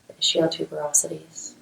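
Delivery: calm, measured meditation voice with long pauses; guided meditation. Acted. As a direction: guide them gently through a body scan, leaving space to breathe. Leads a guided mindfulness meditation.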